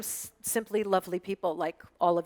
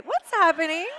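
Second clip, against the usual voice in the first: higher and louder, laughing through the question; laughing confusion; 9 semitones higher, 6 dB louder than usual. Retold or imitated. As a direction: giggle at the baffled Americans. Mocking confused Americans.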